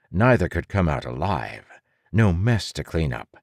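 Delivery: ordinary delivery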